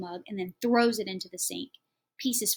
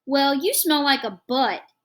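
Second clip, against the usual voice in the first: put-on loud kid insult; 6 semitones higher, 12 dB louder, slower than usual. A narrator's reading. Kids quarrel, mother cuts in.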